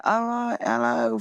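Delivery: British hello starting high, dropping to normal; self-mocking British radio greeting; British accent greeting. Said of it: "fucking with my accent".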